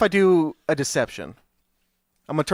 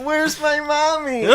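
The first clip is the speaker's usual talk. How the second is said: put-on high, slow little child voice; lost kid voice; 9 semitones higher, much slower than usual. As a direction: sound like a small lost goblin child. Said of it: "I have to do a character voice".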